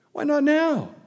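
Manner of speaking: can't believe anyone would wait; exasperated disbelief. Real, not acted. Preacher questioning Pharaoh's delay, exasperated.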